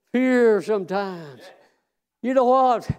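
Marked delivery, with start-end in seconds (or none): none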